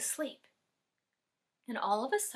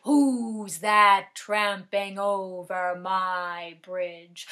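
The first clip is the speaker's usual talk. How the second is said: low, very slow, loud troll; best troll voice; 4 semitones lower, 7 dB louder, much slower than usual. Acted. Troll challenging the littlest goat on his bridge, low and slow. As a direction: draw it out as a menacing troll. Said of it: "said in his best troll voice".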